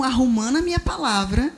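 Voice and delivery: usual speaking voice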